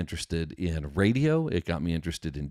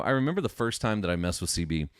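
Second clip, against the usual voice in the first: usual speaking voice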